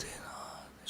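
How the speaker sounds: hushed, slow devotional whisper; a slow, reverent whisper to the Lord. Acted. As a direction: breathe the prayer out slowly. Prayer line sung out softly.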